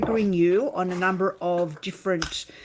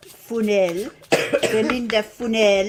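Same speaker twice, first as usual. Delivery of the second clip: put-on French accent; 5 dB louder than usual. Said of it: "give her a French name".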